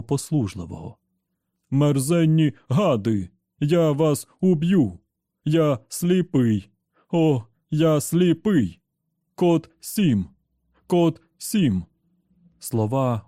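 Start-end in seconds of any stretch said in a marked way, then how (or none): 1.7-11.8 slow, loud, inhuman robot roar; furious machine voice, dragged out; raging robot. Acted. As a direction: bellow it like a broken machine. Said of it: "Цей голос був не дуже схожий на звиклого Енді".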